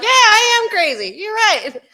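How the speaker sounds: laughing, high and loud mock confession; mock agreement. Gina jokingly agrees she is crazy, laughing high and loud. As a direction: crack up while playing along. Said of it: "I'm just kidding".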